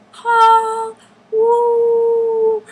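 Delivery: high, loud, drawn-out whoop; excited calling out. Retold or imitated. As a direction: holler to someone across a pool, high and loud. Calling out to Paul at pool.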